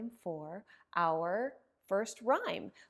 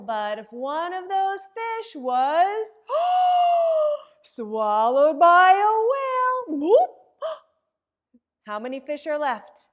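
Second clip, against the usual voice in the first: gasping, much higher and louder whale drama; dramatic gasp; 7 semitones higher, 15 dB louder than usual. Acted. Librarian acting shocked as the whale swallows a fish, gasping. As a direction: make the kids jump at the whale.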